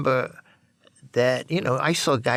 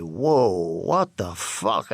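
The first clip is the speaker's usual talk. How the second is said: dazed wonder; 6 semitones lower, much slower than usual. Retold or imitated. First heroin high hits.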